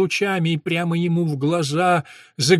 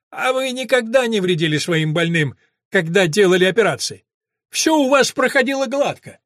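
higher, mocking scholar's voice; sneering professor; 6 semitones higher, 5 dB louder than usual. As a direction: taunt him like a cornered professor. (насмешливый голос профессора)

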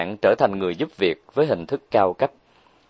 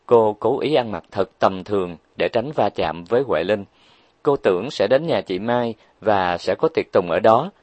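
usual speaking voice

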